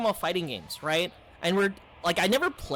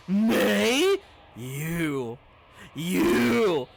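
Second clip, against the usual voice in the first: mock scornful "Mei?" with a sneer; a hint of contempt, lightly voiced; disdainful; 3 dB louder, much slower than usual. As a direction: play the scornful friend looking down on him. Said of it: "they treated me with such disdain".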